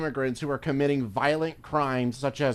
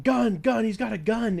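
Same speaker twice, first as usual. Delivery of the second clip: high, loud mock panic; panicked yell; 9 semitones higher, 3 dB louder than usual. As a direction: imitate a cop panicking. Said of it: "the cop just starts going".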